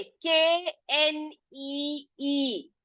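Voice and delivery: केएनईई very slowly, letter by letter; each letter of केएनईई long and separate; spelling out. Shown as a demonstration. Teacher showing the word for knee, spelling out. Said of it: "क्या है ये?".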